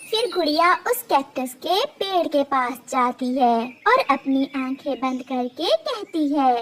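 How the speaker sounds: ordinary delivery, nothing marked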